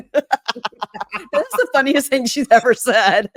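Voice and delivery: real laughter through the words, high and loud; laughing. Real, not acted. Host amused by Shantae's comeback, laughing. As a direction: crack up while talking, drawn out. Host cracks up at retold line.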